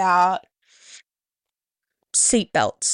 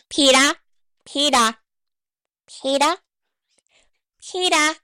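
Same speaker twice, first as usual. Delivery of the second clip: high-pitched cartoon wife calling her husband; repeated short high calls in a put-on cartoon voice; Lois Griffin; 7 semitones higher than usual. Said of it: "Lois Griffin".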